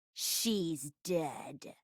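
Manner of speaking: low, very soft, drawn-out realisation; stunned, sunken low voice; hushed shock. Acted. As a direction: let it drop to a grave hush. Discovering the poisoned girl's death.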